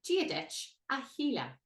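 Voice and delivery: "Gia dich a Sheila" starting from the H; H-start demo. Shown as a demonstration. Teacher shows the H start. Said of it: "start with the H".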